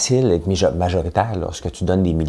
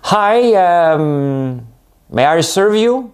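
high, louder mock anglophone clerk; English-speaking clerk; 11 semitones higher, 5 dB louder, much slower than usual. Imitating an English-only clerk on Sainte-Catherine, high and bright. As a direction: play the anglo shop clerk.

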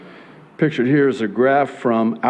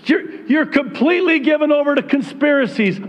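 mock critic; 12 semitones higher than usual.

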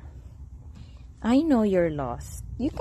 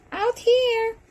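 very high coaxing call; high-pitched pet talk; directing a pet; 12 semitones higher than usual. Real, not acted. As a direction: coax a cat over. Directing the cat outside.

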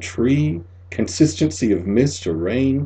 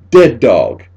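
command to dog; 9 dB louder than usual. Retold or imitated. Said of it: "I tell him".

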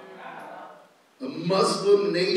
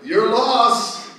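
loud, higher mock-cocky send-off; 7 semitones higher, 8 dB louder than usual. Missionary answering the cessationist's refusal, cocky. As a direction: toss it off with imitated swagger. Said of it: "Like, kinda cocky".

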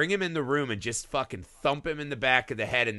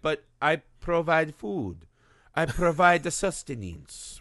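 Italian cat voice; 3 semitones higher than usual. Retold or imitated. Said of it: "the cat's like an Italian".

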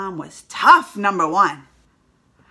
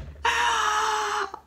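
laughing shriek; 11 semitones higher than usual. Real, not acted. Laughing loudly after.

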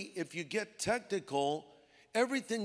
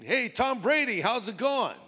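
high, jovial-sounding tease; mock hailing; 6 semitones higher than usual. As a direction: greet as if teasing with a nickname. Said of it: "Almost like mockery".

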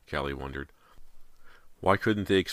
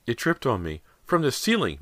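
high-pitched, halting explanation; shaken explaining; 8 semitones higher, 4 dB louder than usual. A narrator's reading. Mother explaining the blood to her husband, shaken. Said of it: "Missus Fraser explained".

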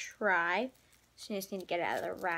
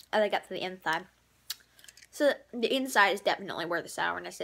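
sucking on sour candy; squinting at sour taste; candy in mouth. Real, not acted. Seen 1: talks with candy in mouth, squints at sourness 4-14s.

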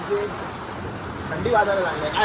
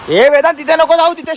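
sharp warning call; 8 semitones higher, 5 dB louder than usual. Real, not acted. Uncle warning Vedant away from the sparking wire, raised urgent call. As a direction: yell to stop him going near the sparks.

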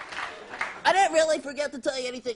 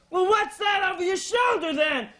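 high-pitched comic accusation; accusing forest ranger; 4 semitones higher than usual. Acted. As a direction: demand it as a hammed-up officious ranger. Acts ranger accusing man.

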